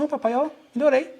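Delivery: a hint of a proud little boy showing he can walk; son's happy voice. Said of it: "ele falou assim".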